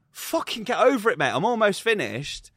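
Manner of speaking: high, exasperated telling-off; irritated retort. Retold or imitated. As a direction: re-enact snapping at someone in your way. Retold gym argument, losing patience.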